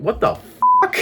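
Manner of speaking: very high, loud squeak of disbelief; shocked squeal. Real, not acted. Shocked reaction.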